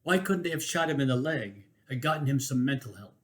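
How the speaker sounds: ordinary delivery, nothing marked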